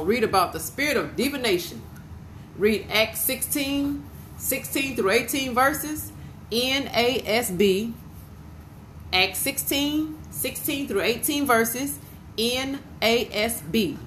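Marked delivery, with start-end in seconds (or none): 2.6-7.9 slow and higher, drawn out; dictating a reference. Real, not acted. Dictating a scripture reference to listeners. 9.1-14.0 slow and higher, drawn out; dictating a reference. Real, not acted. Repeats the reference for listeners.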